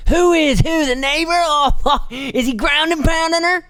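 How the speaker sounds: high squeaky voice for the operator; mock operator squeal. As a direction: squeak out the question, laughing. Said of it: "And she's like".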